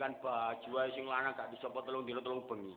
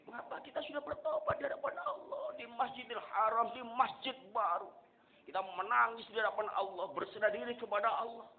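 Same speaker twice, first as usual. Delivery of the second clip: high, raised, pleading sermon voice; pleading preaching; 10 semitones higher, 4 dB louder than usual. Acted. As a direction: plead with the congregation. Preaching at full force. (khotbah tinggi memohon)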